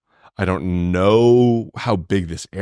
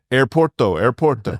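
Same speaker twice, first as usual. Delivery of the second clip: mock Spanish accent; 3 semitones higher than usual. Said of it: "cab driver going".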